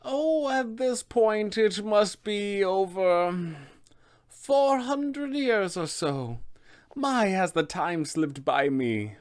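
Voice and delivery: slow, high, wistful crone; Baba Lysaga. Acted. Witch recalling how long she has lived here, slow wistful crone voice. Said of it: "this answer is going to really hit them hard".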